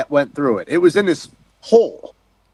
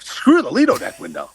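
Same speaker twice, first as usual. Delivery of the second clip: pitched-up, sweeping dismissal; excited outburst; 6 semitones higher than usual. Retold or imitated. Diver telling himself to drop the window for the compass, excited. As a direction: toss it aside in a high, excited swoop. Excited change of mind on dive.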